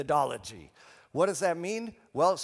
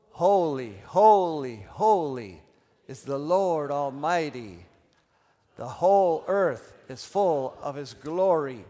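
firm, slow, louder recitation; normal voice, projected; 8 dB louder, slower than usual. Shown as a demonstration. Preacher leading both sides the second time, firm voice. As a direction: lead them clearly at full speaking volume. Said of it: "say it in a normal voice".

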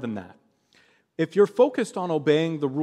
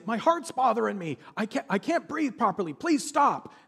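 imitated screaming plea; 7 semitones higher than usual. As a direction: act out a shocked victim begging. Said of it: "The students were screaming".

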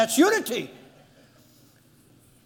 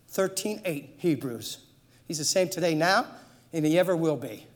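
usual speaking voice

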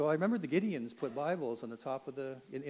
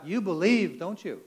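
loud, high-pitched retold yell; yelled challenge; 5 semitones higher, 11 dB louder, slower than usual. Satanist yelling back across the cell block, loud challenge. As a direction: yell it back as an accusing question. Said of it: "he yells back".

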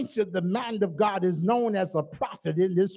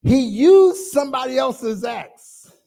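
usual speaking voice

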